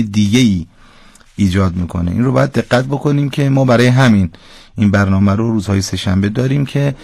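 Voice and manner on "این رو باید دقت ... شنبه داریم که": ordinary talk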